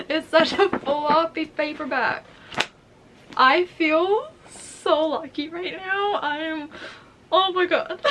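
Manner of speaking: high, loud, stretched-out gushing; overwhelmed excitement. Real, not acted. Vlogger feeling so lucky with the book, giddy and overwhelmed. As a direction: squeal it slowly, on the verge of laughter. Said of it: "I'm so excited".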